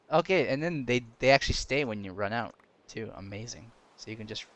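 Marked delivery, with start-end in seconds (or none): none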